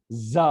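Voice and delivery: "ज" as a voiced zzz-uh; buzzing z. Shown as a demonstration. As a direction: make the mouth buzz on "ज". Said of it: "मुंह थोड़ा झन्नाना चाहिए".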